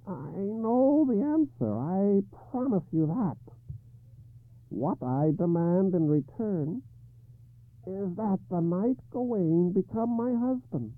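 high, slow harsh croak of a hag. Acted. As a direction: croak it out like a grotesque old woman. Ragnall's demand.

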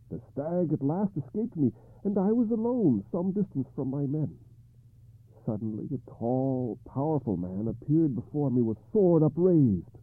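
high, troubled king; King Arthur voice. Acted.

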